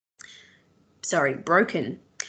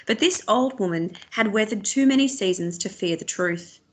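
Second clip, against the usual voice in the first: ordinary delivery, nothing marked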